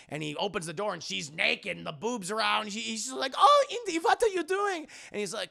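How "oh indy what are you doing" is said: put-on high, startled woman with a German accent. Elsa surprised in the bathroom by Indy, German accent. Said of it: "You have a very German accent".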